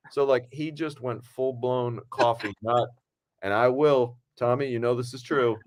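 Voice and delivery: usual speaking voice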